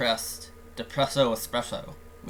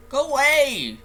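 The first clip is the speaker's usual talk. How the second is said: high, loud dismissal; upset shout; 12 semitones higher, 6 dB louder, slower than usual. Acted. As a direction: shout the visitor away in a higher voice. Acted friend sends visitor away.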